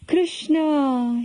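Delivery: long drawn-out, high call of the name; despairing cry. A narrator's reading. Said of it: "It was a despairing cry".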